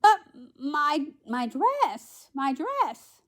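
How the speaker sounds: high, halting storybook protest; flustered Cinderella. A narrator's reading. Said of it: "said Cinderella".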